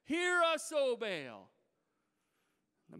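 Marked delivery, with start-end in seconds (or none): 0.1-1.5 loud pleading cry, pitched up; hollering to Baal. Retold or imitated. Prophets of Baal crying out, loud pleading holler. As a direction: cry out desperately to a silent god. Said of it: "they begin to holler out to Baal".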